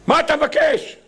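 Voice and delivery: acted exasperated challenge; demanding shout. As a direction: burst out with the question. God's sharp question after rebuke.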